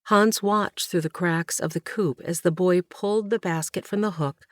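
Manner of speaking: plain reading voice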